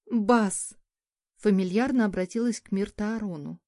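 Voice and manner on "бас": pouting, a bit louder call; capricious. A narrator's reading. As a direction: call his name with a pout. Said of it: "капризно надула губы".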